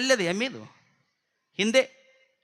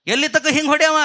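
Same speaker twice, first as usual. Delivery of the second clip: protesting shout; 6 semitones higher, 6 dB louder, faster than usual. Acted. Elder brother shouting in protest.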